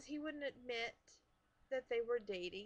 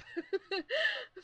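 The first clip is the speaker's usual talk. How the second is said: high, loud burst of laughter; sudden loud laugh; 8 dB louder than usual. Real, not acted. Laughs while wrapping gifts.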